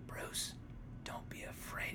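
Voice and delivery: whispered, hushed and slow; calm whisper. Retold or imitated. Friend mocks a dying father's calm last words, whispered. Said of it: "Thomas turns over so calmly".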